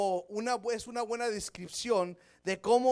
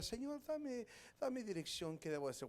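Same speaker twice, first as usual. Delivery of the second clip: soft murmured plea for guidance; hushed prayer; 2 semitones higher, 12 dB quieter than usual. Retold or imitated. Pastor imitating his own prayer for direction, hushed. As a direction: pray it quietly, almost to yourself.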